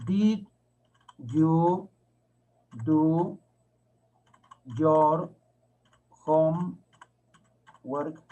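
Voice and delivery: very slow, isolated words; one word at a time with long gaps; drawn-out teacher dictation of a question. Shown as a demonstration. Teacher dictates question while writing it.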